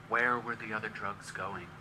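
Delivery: a hint of Dustin Hoffman murmur; Dustin Hoffman impression. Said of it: "This is Dustin Hoffman".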